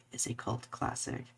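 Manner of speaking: unvoiced conspiratorial whisper; whispered fan in-joke to co-host. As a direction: whisper it like a fandom secret. Fandom in-joke aside.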